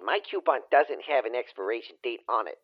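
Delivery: ordinary talk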